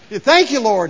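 eager urging. Acted. Rider keeps the horse galloping, eager urging. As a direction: call out to spur the horse on.

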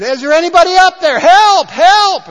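a man dangling off a cliff, screaming up; man on a cliff calling to heaven, high panicked yell; yelling for help. Retold or imitated. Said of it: "he starts yelling for help".

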